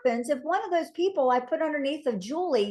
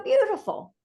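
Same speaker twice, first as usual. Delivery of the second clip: delighted; 9 semitones higher than usual. Retold or imitated. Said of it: "I go".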